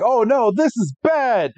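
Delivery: a touch of cartoon alarm; worried character. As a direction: sell the cliffhanger panic lightly. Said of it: "it's like".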